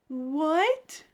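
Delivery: high, rising disbelief; one stretched, appalled question; incredulous. Real, not acted. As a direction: let it swoop up in shock. Reacts to a callous stepdad.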